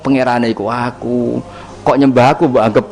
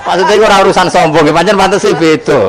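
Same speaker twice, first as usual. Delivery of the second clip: fast, louder, words tumbling through laughter; laughing; 5 semitones higher, 5 dB louder than usual. Real, not acted. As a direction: talk while still laughing. Laughing while explaining. (ngomong karo ngguyu)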